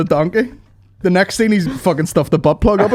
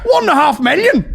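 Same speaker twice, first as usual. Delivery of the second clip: high, crowing mock farmer; Irish farmer; 10 semitones higher, 3 dB louder than usual. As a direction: boast like a rich Irish farmer. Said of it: "the guy's a fucking millionaire and he's like".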